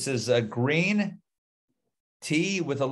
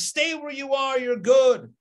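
higher, louder call to stay put; voice of water calling; 10 semitones higher, 3 dB louder, slower than usual. Acted. Teacher voicing water telling you stay, calling out. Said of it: "The water says".

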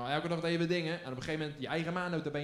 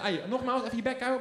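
brutaal kind; 7 semitones higher, 4 dB louder than usual. Retold or imitated. Child tells teacher shut up.

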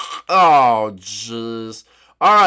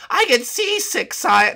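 whiny guard; 11 semitones higher, slower than usual. Acted. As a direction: whine up high at being woken. Banana Guard voiced.

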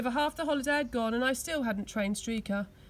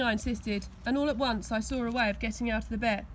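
plain reading voice

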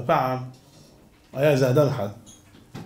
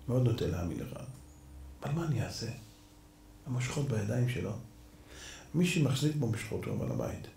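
much softer and lower, sorry but firm; quiet regretful judge; 8 semitones lower, 7 dB quieter, faster than usual. Acted. Judge tells the true owner he lost, soft and regretful. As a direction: break it to him gently.

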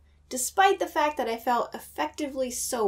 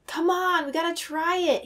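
eager, pitched-up urging; excited; 7 semitones higher than usual. A narrator's reading. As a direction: push them to try it. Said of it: "sounding excited".

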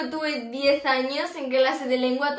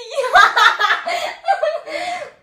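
high excited giggle; a burst of giggling; 14 semitones higher, 8 dB louder than usual. Real, not acted. Se ríe al confesar novio. (risa nerviosa y aguda)

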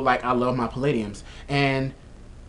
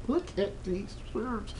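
softer, slower, higher hush; hushed admiring; 6 semitones higher, 12 dB quieter, slower than usual. Shown as a demonstration. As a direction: breathe it out in awe. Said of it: "Like, look at these shoes".

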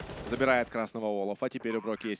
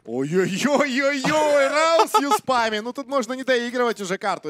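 excited scream; 13 semitones higher, 10 dB louder than usual. Real, not acted. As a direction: scream at the multikill. Caster screams at multikill.

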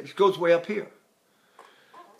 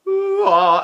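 much higher falsetto ah; 13 semitones higher, 7 dB louder than usual. Shown as a demonstration. Voice teacher showing falsetto attachment. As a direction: flip into falsetto. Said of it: "attachment of falsetto to the voice".

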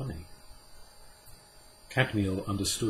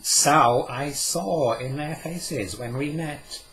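Cadmiel, a touch amused; 6 semitones higher, 7 dB louder, slower than usual. Acted. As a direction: play the learned old man, gently amused. Cadmiel's voice in the story.

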